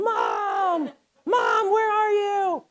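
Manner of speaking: high, loud, slow panicked cry for mom; panicked child. Retold or imitated. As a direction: scream for mom like a terrified lost kid. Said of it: "I'm, like, crying, I'm freaking out".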